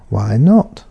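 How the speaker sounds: "not" rises at the end as a question; rising tone. Shown as a demonstration. Teacher repeating the question example to learners, rising on "not". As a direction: lift "why not" upward. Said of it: "Another question".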